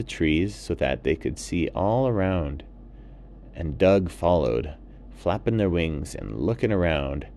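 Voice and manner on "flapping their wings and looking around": ordinary delivery, nothing marked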